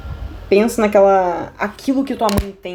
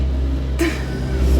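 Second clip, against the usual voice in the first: unvoiced giggle at own joke; breathy giggle; 9 semitones higher, 4 dB quieter than usual. Real, not acted. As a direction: laugh through the air, no voice. (risadinha sem voz)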